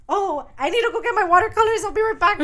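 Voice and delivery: high-pitched, lightly mocked girl excusing herself; put-on eager high voice; eager excuse. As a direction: chirp it high, on the edge of laughing. Voicing Felicity excusing herself.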